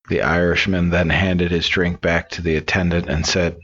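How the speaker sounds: usual speaking voice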